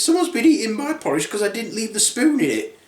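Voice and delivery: a hint of an indignant mother bear; Mummy Bear. Mother bear finding her porridge eaten, indignant. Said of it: "Mummy Bear then says".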